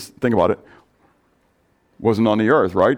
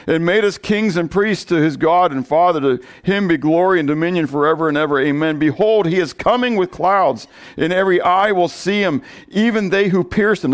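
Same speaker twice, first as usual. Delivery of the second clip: Bible reading lifted higher, building; scripture reading; 5 semitones higher than usual. Acted. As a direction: proclaim the verses to the congregation. Reads scripture aloud.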